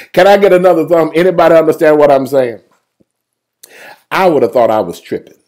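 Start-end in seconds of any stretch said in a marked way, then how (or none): none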